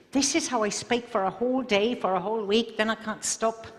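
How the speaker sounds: chatting in a light Irish brogue; Irish accent. Shown as a demonstration. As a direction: keep the Irish lilt going. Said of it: "So Irish".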